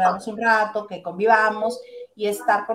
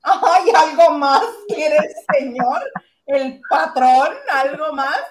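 mocking servant voice, louder; mustache twirling villain; 4 semitones higher, 7 dB louder, slower than usual. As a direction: sneer at the boss with fake servility. Mock servile to "patrón.

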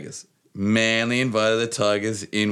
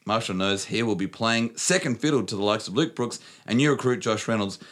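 put-on New Zealand accent; 6 semitones higher than usual. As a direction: keep the Kiwi accent going. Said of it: "don't at me about the, uh, accent".

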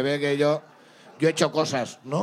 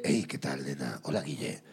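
much lower, softer seductive growl; macho stripper character; 9 dB quieter than usual. Retold or imitated. To the crowd as his stripper character, low and seductive. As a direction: croon it like a cocky stage stud. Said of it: "tener un personaje que era como muy, muy de".

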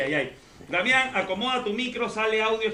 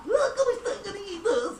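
put-on high, playful children's host greeting; kids show host; 13 semitones higher than usual.

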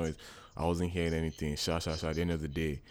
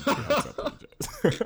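laughing. Real, not acted. Laughing while telling.

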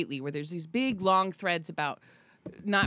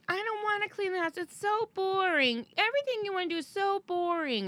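whiny daughter; 11 semitones higher than usual. Retold or imitated. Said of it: "she was like".

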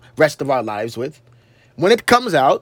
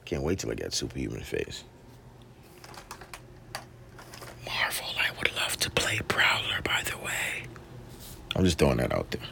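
a hushed, breathy whisper to himself; whispered aside; 16 dB quieter than usual. Real, not acted. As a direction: drop to a secret whisper.

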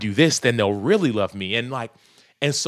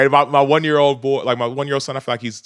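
normal talking voice

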